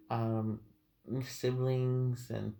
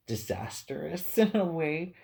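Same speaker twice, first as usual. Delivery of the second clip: laughing; 6 semitones higher, 5 dB louder than usual. Real, not acted. Laughs while predicting disaster.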